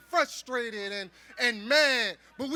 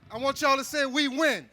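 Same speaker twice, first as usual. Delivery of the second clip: normal talking voice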